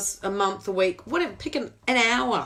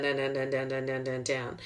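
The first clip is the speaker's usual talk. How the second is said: each "down" lower and softer; sinking voice; 8 semitones lower, 5 dB quieter than usual. Acted. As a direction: let the voice sink away. Voice sinks with the scale.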